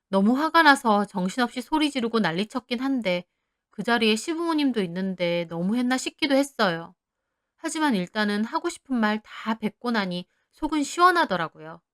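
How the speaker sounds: plain reading voice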